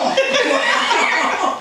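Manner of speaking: a high, loud burst of real laughter; cracking up at the memory; big laugh. Real, not acted. Retelling her bike crash to the host, a real laugh.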